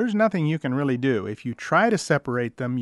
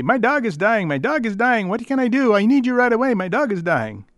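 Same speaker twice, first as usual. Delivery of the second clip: mock panic, much higher and louder; panicked caller; 8 semitones higher, 6 dB louder than usual. Owner calling the vet at night, imitated panic. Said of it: "that initial panicked voice".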